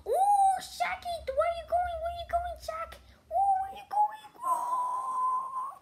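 very high, sing-song teasing; mocking falsetto taunt. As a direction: taunt the opponent in a squeaky voice. Taunting opponent in game.

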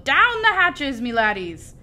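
high, loud, drawn-out toast; hearty pirate holler, easing at the end; pirate captain yell. A narrator's reading. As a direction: bellow it to your crew. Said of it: "pounded his fists on the table and yelled".

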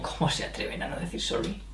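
whispered mock indignation; mock outraged whisper. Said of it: "es como".

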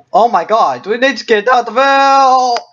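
urgent escape panic; panicked. Real, not acted. Danger, needs to escape.

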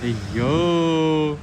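put-on a slow, far-reaching "aiyo" to charm a toddler; exaggerated playful surprise for the child; drawn-out mock wonder. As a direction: stretch the अय्यो long and slow, with playful amazement. Parent marvels at child sitting.

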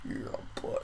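soft, whispery aside; hushed. Real, not acted. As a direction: let it out almost as a whisper. Hushed murmur to self, unvoiced.

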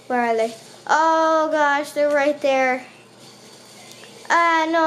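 ordinary talk

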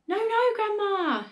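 put-on high, loud child's protest; frantic, high-pitched plea; alarmed cry. A narrator's reading. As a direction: cry out in panic to stop her. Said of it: "George cried".

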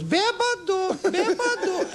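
very high falsetto taunt; piercingly high cartoon call; mysterious taunting voice. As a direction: squeal it like an unseen little heckler. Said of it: "the voice goes".

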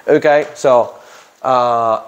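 usual speaking voice

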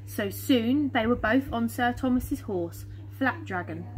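ordinary delivery, nothing marked

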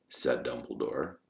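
ordinary delivery

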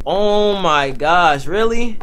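drawn-out exasperated groan. Real, not acted. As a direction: stretch it out in disbelief. Car won't start, bus needed.

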